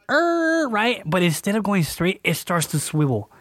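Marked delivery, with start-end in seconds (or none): 0.1-0.7 high, drawn-out "errr" of skidding tires; high-pitched skid noise; imitated tire screech. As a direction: mimic the car braking hard. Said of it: "The car just goes".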